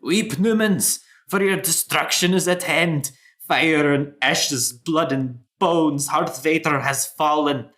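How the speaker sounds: high, urgent doomsayer's proclamation; frantic, tremulous. Acted. Dwarf warning the marketplace of an undead army, frantic and tremulous. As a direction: cry out a frantic warning, stretching the key words. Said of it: "there's a notable tremor in his frantic voice".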